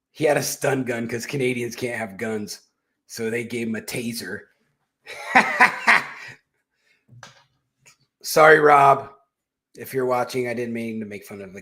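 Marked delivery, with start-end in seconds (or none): 5.1-6.3 high unvoiced laugh. Real, not acted. Laughs at own joke.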